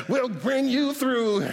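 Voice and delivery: high, slow chanted phrase; mock whooping sermon cadence; imitated preacher tuning up. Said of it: "when you tune up".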